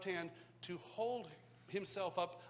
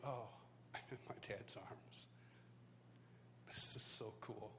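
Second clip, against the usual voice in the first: hushed awe; 9 semitones lower, 12 dB quieter than usual. Retold or imitated. Awed inner thought in dad's arms.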